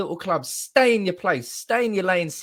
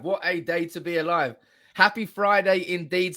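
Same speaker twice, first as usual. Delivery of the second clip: ordinary delivery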